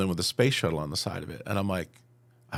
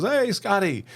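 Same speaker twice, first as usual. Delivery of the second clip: calling out; 11 semitones higher, slower than usual. Retold or imitated. Calling out from bus.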